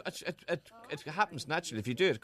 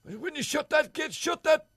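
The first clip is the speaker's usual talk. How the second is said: high, loud mock holler; hammed-up yelling; Gregory Campbell; 14 semitones higher, 5 dB louder than usual. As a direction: shout it like an irate farmer. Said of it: "for Gregory".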